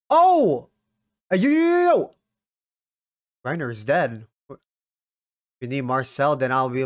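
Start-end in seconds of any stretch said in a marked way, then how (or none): 0.1-2.1 high, loud startled yell; shocked yell. Real, not acted. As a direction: jump out of your seat. Shocked reaction.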